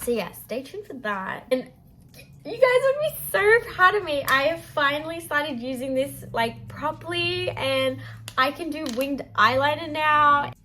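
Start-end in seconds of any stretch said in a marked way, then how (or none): 2.4-10.5 high, loud proud announcement; excited. Real, not acted. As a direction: gush about your new skill.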